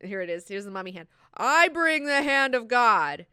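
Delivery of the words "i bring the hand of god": high, loud, slowly drawn-out proclamation; mock-prophetic announcement to the masses; proclaiming preacher. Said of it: "he's like".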